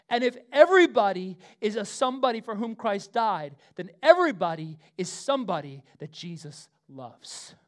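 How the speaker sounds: loud, high, fervent pulpit delivery; full-voiced sermon cadence; preaching. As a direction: preach it to the whole room.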